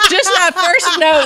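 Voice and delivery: high, laughing delight. Real, not acted. Telling how ladies swooned, laughing. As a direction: laugh as you tell it.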